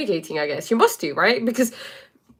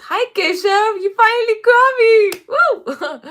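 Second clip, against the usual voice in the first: much higher, loud thrilled cry; excited greeting; 7 dB louder, slower than usual. Real, not acted. Greeting Keshav who finally joined the live, thrilled loud cry. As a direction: squeal hello to a friend who finally made it.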